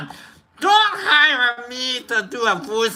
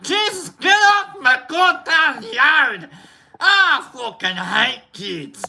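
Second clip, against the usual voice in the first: slow, raised-pitch Scottish grumble; mock angry Scot; 3 semitones higher, slower than usual. Grumpy man chasing kids off the yard, mock angry. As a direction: play a cranky Scot, stretching the words.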